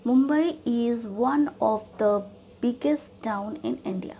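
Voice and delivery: slow dictation. Shown as a demonstration. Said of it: "अब देखिए".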